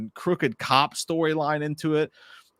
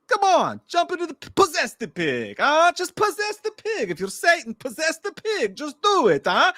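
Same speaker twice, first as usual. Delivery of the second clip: very high, hammed-up Italian accent; Russell Crowe; 14 semitones higher, 3 dB louder than usual. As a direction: goad the demon in mock Italian, drawing it out. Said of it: "that's kinda how he's talking through parts of the movie".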